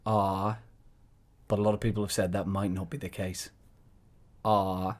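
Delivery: low, soft put-on documentary voice; documentary interviewer voice. Probing a supposed expert in a mock documentary, low interviewer voice. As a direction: drop low and quiet, with a drawn 'uh'. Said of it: "What is that voice?".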